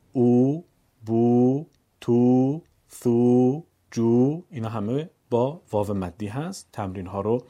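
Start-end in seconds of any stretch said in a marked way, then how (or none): 0.1-4.4 each syllable held long and louder; long u, two beats. Shown as a demonstration. Teacher reads the long vav row. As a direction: draw every vowel to two beats.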